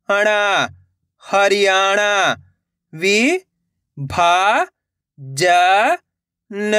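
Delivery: each syllable said on its own with a pause, then the whole word; teacher sounding out Hindi words syllable by syllable. Shown as a demonstration. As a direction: spell out every akshar slowly, then blend it into the word. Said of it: "मैं किस तरीके से एक एक अक्षर का उच्चारण करके इनको पढ़ रहा हूँ".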